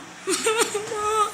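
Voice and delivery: high hammed-up fake wail; mock sobbing cry. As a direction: wail like a jilted wife, but comic. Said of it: "She cries".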